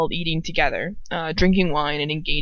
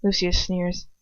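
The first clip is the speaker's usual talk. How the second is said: ordinary delivery, nothing marked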